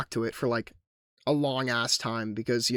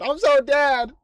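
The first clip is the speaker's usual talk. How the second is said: higher, louder comic despair; mock panicked yelp; 10 semitones higher, 7 dB louder, slower than usual. Player voicing his character about to be killed, mock panic. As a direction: wail it as if doom is certain.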